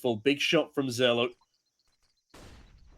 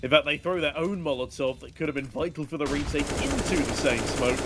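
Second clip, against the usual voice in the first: usual speaking voice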